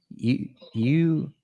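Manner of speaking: drawled Southern twang; Southern accent. Retold or imitated. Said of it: "Your, your Southern accent almost came out".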